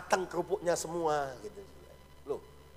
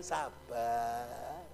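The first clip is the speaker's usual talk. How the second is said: ordinary delivery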